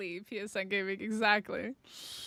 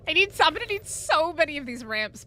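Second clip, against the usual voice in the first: ordinary delivery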